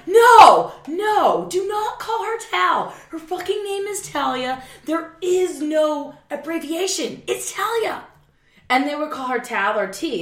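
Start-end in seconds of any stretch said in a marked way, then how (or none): 0.1-8.0 high, loud outraged correction, voice jumping up; furious protest. Retold or imitated. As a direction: snap back hard that her name is Talia, no nickname. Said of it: "I'm like".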